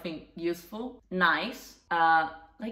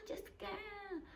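much higher, much softer scornful mock lady; mocking the neighbour; 15 dB quieter than usual. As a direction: sneer it high and breathy-free like the neighbour. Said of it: "She was like".

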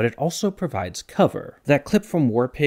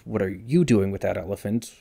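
accent lands on YOU, rising at the end; stress on YOU. Shown as a demonstration. Comparing six questions for viewers, stress on YOU. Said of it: "where you place the accent".

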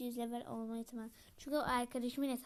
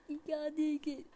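firm mock teacher voice, a little louder; 3 semitones higher, 4 dB louder than usual. Pretending to be a teacher quizzing the class, mock firm. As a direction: quiz the class like a playful teacher. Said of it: "Ya ben öğretmenim".

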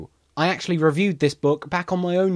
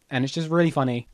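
ordinary talk

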